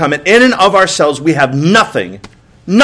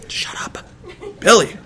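hissed aside; 3 semitones higher, 7 dB quieter than usual. Retold or imitated. Hissed at kid in car.

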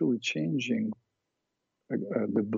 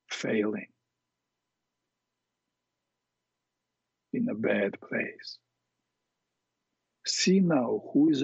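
guided meditation. Acted. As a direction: guide them calmly through it. Leads a guided imagery exercise.

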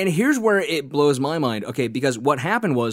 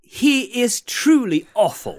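high, slow hammed-up English accent; mock olde english; 9 semitones higher, 5 dB louder, slower than usual. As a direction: ham it up like a Shakespearean player. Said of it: "adding a few extra words in there".

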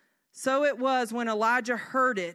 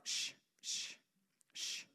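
soft spaced-out shushes; self-soothing shush; 14 dB quieter than usual. Retold or imitated. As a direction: hush yourself quietly. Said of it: "just tell myself".

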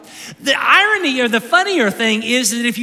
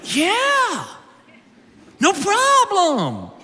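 much higher, slow, careless brush-off; mock bravado; 9 semitones higher, much slower than usual. Mimicking cocky disciples, hammed-up breezy confidence. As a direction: toss it off like nothing could go wrong.

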